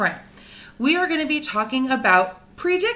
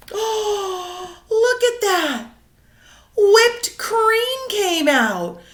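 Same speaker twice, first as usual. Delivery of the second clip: amazed reveal; 7 semitones higher, much slower than usual. Pretend. Teacher to class, showing the twist in wonder. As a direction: savour the surprise.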